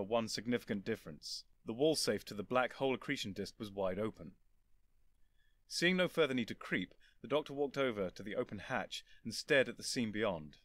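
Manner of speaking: usual speaking voice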